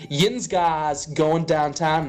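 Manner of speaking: slow, careful Pittsburgh accent, Yin's drawn out; a lightly done Pittsburgh accent, said slowly. Shown as a demonstration. Repeating the Pittsburgh phrase slowly for friends. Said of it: "So I said".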